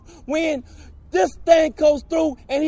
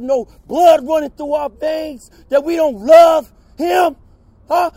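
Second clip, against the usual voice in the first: impassioned shout; 5 dB louder than usual. Real, not acted. Impassioned protester at hearing.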